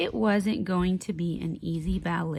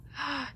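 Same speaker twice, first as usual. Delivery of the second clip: gasp; 7 semitones higher, 7 dB quieter than usual. A narrator's reading. Reader gasps.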